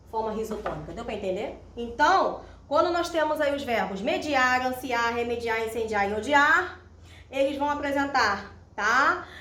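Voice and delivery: usual speaking voice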